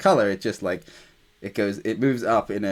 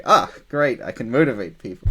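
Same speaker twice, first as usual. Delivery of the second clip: pleased, louder exclamation easing off; mock-triumphant, upbeat aside; acted excitement; 5 dB louder than usual. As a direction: play a delighted little realization. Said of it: "they'll start getting excited too".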